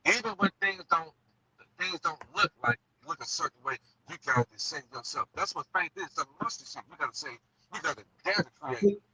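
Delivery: muffled, like through a wall; muffled mic sound. Real, not acted. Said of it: "You sound muffled now".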